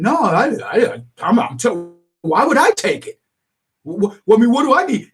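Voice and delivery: flustered roommate denying it; defensive. Retold or imitated. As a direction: act caught out and indignant. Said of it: "And then he gets defensive".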